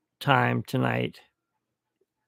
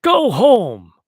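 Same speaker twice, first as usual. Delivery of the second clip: loud angry yell, much higher; dad yelling; 8 semitones higher, 11 dB louder than usual. Retold or imitated. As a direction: bark it like a furious dad. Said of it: "he looked at Doug and he goes".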